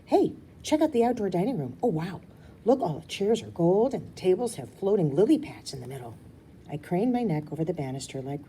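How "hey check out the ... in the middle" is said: put-on excited child voice. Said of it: "on to the next thing".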